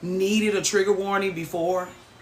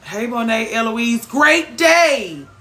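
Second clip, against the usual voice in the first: excited greeting; 5 semitones higher, 8 dB louder, much slower than usual. Real, not acted. Greeting a viewer in chat.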